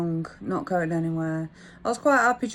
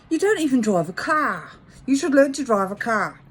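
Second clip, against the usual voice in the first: mock London nagging, raised and loud; London accent; 5 semitones higher, 6 dB louder than usual. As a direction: imitate a pushy Londoner. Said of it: "he said in his London accent".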